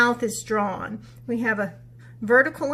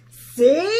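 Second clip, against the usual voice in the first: triumphant animals; 4 semitones higher, 9 dB louder than usual. Acted. Animals, loud.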